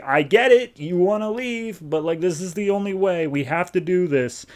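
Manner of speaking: lightly voiced high-pitched Atrocitus, half-hearted menace; a touch of a creepy coaxing devil; high villain voice. Atrocitus coaxing the panicking Butcher, in a high mock villain voice.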